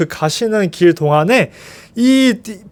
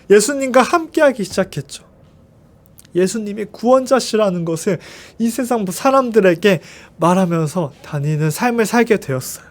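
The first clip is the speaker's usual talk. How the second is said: ordinary talk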